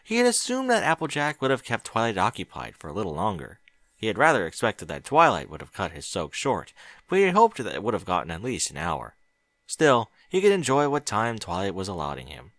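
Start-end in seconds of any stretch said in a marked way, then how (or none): none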